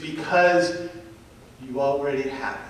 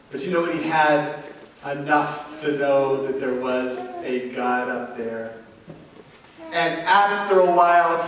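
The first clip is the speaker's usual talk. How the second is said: ordinary delivery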